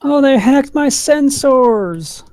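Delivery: alarmed cry. Real, not acted. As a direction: cry out as the game goes wrong. Game loss reaction.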